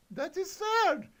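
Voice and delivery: put-on very high, piping girly voice; squeaky Miriam the wizard. Reading the kid's story, Miriam tells the spiders in a very high squeak. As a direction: squeak it like a little pony wizard.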